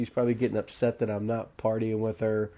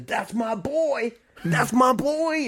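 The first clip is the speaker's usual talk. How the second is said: high, drawn-out proud cry; imitated gleeful dad boast; proud father; 11 semitones higher, much slower than usual. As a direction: stretch it out with fatherly delight. Proud father recognises son's feat.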